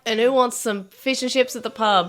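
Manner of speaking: hammed-up rowdy Aussie; put-on Australian accent. Australians calling mates to the pub, exaggerated Australian accent. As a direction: rouse the mates in broad Australian. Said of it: "putting on Australian accent".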